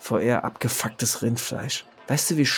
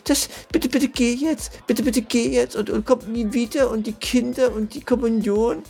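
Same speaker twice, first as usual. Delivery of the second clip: hohe, flehende Spottstimme einer weinerlichen Frau; spöttisch als verheulte Ehefrau betteln; nachgeäffte Ehefrau; 11 semitones higher than usual. Retold or imitated. S1 äfft die Frau weinerlich nach. (hohe, weinerliche Spottstimme der Ehefrau)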